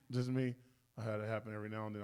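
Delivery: ordinary talk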